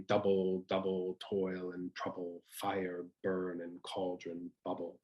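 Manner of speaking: slow chant stressing the first syllable of "Double", "trouble", "cauldron", "bubble"; trochaic stress. Shown as a demonstration. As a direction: beat out "Double, double toil and trouble" strong-weak, slowly. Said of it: "the trochaic meter can sound a little bit off".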